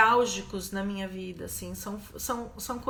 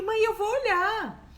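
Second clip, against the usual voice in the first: higher, firm retold reply; insisting to mother; 10 semitones higher, 3 dB louder than usual. Daughter telling her mother she will face it, higher and firm.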